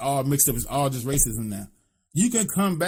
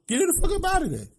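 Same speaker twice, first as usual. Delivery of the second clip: mock dismissive order; faster than usual. Voicing the university firing her.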